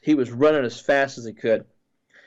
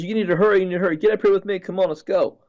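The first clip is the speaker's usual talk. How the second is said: retold yell; 6 semitones higher, faster than usual. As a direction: hurry them along in a mild mock yell. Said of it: "he yells and says".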